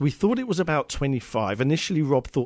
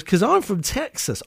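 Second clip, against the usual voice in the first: put-on a half-hearted stab at a Texan American accent, pitched higher; failed American accent; 8 semitones higher than usual. Quoting the Texan girl in a weak American accent. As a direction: try a Texan American accent and admit it slips. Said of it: "I can't do the American accent".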